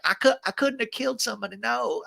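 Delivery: lightly voiced stammering innocent; stuttering guy. Host imitating Edward Norton's stuttering character, light high stutter. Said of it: "playing this stuttering guy".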